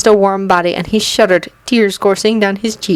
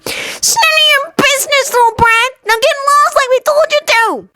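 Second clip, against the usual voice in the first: high-pitched nasal voice. Acted. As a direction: squeak it out like a whiny bully. Tallest bully telling Anakin off.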